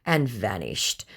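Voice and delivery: normal talking voice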